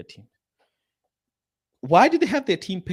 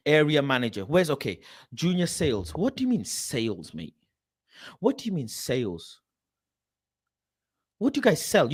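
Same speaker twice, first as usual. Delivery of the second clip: ordinary talk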